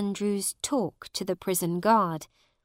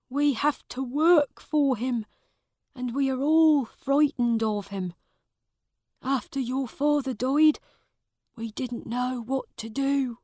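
put-on raised, anxious old servant voice; worried old servant; 6 semitones higher than usual. A narrator's reading. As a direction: tell it like a worried old servant sharing bad news.

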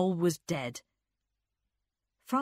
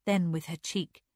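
plain reading voice

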